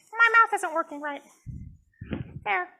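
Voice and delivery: puppet voice. Acted. Puppet Jasmine complains to the counselor in a high voice. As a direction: fuss as a little puppet.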